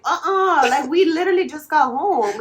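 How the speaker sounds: high, loud retold denial; mock-indignant objection; indignant protest. Retold protest against restaurant's blame.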